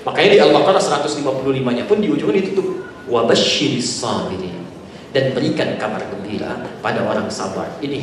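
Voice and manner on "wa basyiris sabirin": recited verse. Acted. Preacher quoting a Quran verse.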